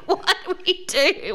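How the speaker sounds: high, laughing what-do-we-do; laughing panic. Retold or imitated. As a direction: ask it through helpless laughter. Panicked laughing family retold.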